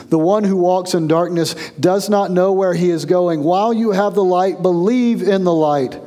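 sermon read at full voice; impassioned preaching. Acted. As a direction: preach it out to the congregation with force. Preaches the passage with rising force.

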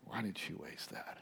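unvoiced, secretive grumble to a neighbour; hushed plotting whisper; murmured whisper. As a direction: whisper it like a scheming aside. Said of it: "they murmur to one another".

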